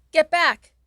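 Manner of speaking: loud, high warning yell. Acted. You yelling at the Rat Man to get back, loud and high. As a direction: yell to drive him back. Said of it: "you yell".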